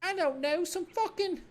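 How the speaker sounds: high, slow, sneering brat voice; bratty kid Kuno. Acted. Cocky kid mocking the cop, in a high bratty voice.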